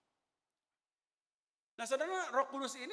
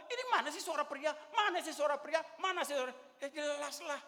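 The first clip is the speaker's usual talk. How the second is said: high, loud mock nagging; imitated scolding; 7 semitones higher, 4 dB louder than usual. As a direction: scold the men like an exasperated choir leader. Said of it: "Dia ngomel, dia ngomel-ngomel".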